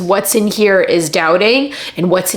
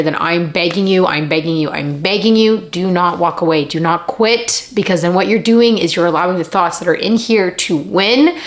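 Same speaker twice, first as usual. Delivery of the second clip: usual speaking voice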